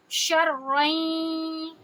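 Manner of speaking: شرعیں slow, the ending nasalised; Quran recitation. Shown as a demonstration. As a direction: chant شرعیں with a held nasal. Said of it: "دو حرکہ آواز کو لمبی کریں گے". (شرعیں غنہ کے ساتھ لمبا)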